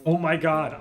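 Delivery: normal talking voice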